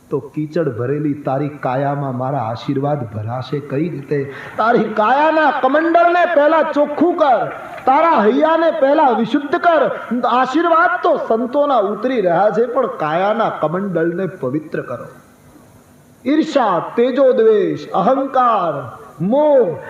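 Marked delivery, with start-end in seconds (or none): 4.6-15.1 much higher and louder, preaching at full force; forceful exhortation. Acted. Preacher urging the listeners to purify themselves, loud fervent call. 16.2-19.8 each vice flung out high and loud, the third stretched slow; emphatic list. Acted. Preacher naming the vices to the crowd, loud punched words.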